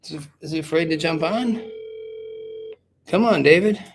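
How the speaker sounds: teasing call-out. Real, not acted. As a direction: heckle him for not showing up. Calling out absent co-host.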